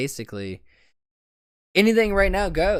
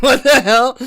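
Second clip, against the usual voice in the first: higher, louder baffled yell; baffled outburst; 13 semitones higher, 14 dB louder than usual. Retold or imitated. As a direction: shout it in disbelief. Imagined viewer reaction.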